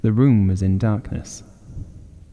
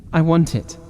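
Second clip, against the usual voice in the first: ordinary delivery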